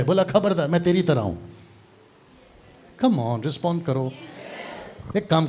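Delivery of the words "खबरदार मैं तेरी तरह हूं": ordinary talk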